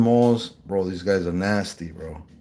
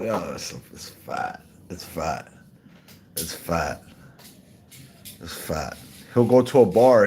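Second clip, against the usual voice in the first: ordinary talk, nothing marked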